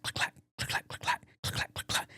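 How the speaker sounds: unvoiced imitated train clacking; whispered train clacks. Shown as a demonstration. Host imitating the nearby train, whispered clacking. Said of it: "you clack".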